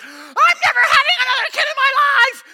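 a high, loud, crying vow in pain; tearful shout. Retold or imitated. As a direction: wail it mid-labor. Mom in labor swearing off.

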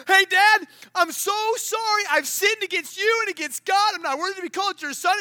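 high, loud, pleading son blurting his sorry; loud pleading apology. Retold or imitated. Son apologising to his dad, loud and pleading. Son's rehearsed apology, voiced loudly.

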